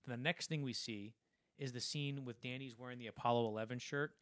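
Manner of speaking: ordinary talk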